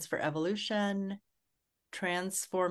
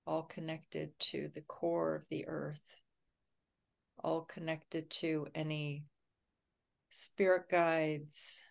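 guided meditation. Acted. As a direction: ease everyone into calm and safety. Leads a grounding meditation.

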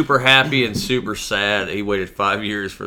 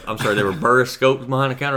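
ordinary delivery

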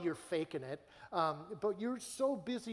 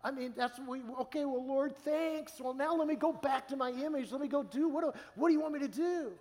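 much higher, louder flustered rush; mock self pleading; 8 semitones higher, 4 dB louder than usual. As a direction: ham up your anxious, image-chasing self. Pastor mimics his own pleading.

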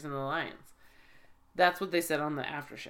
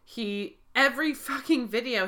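ordinary talk

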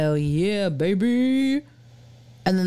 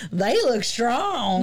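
put-on Southern accent; 4 semitones higher, much slower than usual. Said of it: "we crushed the".

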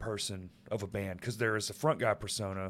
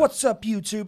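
high, loud mock vlogger greeting; hammed-up YouTube opener, pitched way up; YouTuber intro; 10 semitones higher, 8 dB louder than usual. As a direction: parody an over-excited YouTuber. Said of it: "no one fucking talks".

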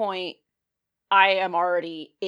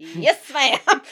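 laughing; 11 semitones higher, 9 dB louder than usual. Retold or imitated. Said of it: "And I put".

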